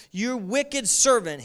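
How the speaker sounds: loud, slow, high rebuke; wicked servant rebuke. A narrator's reading. Master rebuking the unforgiving servant, loud and slow. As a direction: thunder it down at him slowly. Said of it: "he said".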